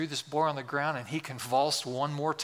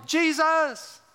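raised-volume cry for mercy; yelling louder; 9 semitones higher than usual. Acted. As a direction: call out over a crowd, pitched way up. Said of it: "He raises the volume".

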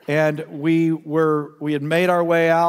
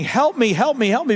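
high, loud retold scream for help; desperate shout, pitched high and louder; terrified cry for help; 7 semitones higher, 3 dB louder than usual. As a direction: relive the panic of the drowning dream. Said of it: "I'm just screaming".

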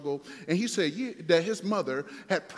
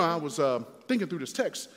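ordinary talk

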